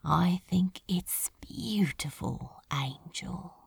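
low unvoiced hiss; hushed hiss to his angel. A narrator's reading. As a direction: hiss it with quiet awe. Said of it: "he hissed".

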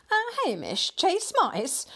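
high-pitched incredulous question; chuckling disbelief. A narrator's reading. Husband doubting the plump cat could hunt, amused disbelief. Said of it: "Mister Grinling chuckled".

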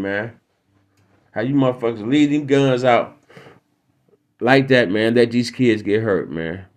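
normal talking voice

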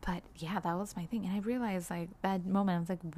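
normal talking voice

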